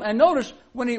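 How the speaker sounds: ordinary delivery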